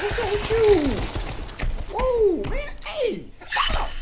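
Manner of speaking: high, loud panicked yells; panicked yelling. Real, not acted. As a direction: shout as it grabs you. Fending off grabbing enemy.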